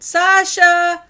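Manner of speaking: very high, very loud shout of the name. A narrator's reading. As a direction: yell for the lost cat at full voice. Calling the cat outside.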